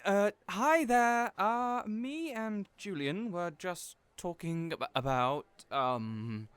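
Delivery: flustered stammer, pitch jumping up; caught off guard. Acted. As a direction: stumble over the words as if caught out.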